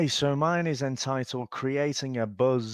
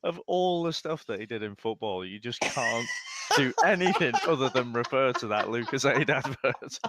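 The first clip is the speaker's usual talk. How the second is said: ordinary talk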